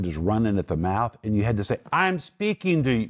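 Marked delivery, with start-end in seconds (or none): 1.9-3.1 raised voice. Retold or imitated. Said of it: "You have to raise your voice".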